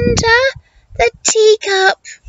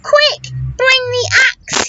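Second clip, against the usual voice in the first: higher urgent call for mother; high mock shout for mother; 9 semitones higher than usual. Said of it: "he shouted".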